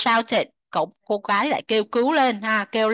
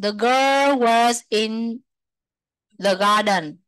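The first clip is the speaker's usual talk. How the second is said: very slow, each word separate; slow model sentence; much slower than usual. Shown as a demonstration. Teacher models past tense sentence very slowly. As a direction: drag it out so students follow. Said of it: "teacher models the sentence".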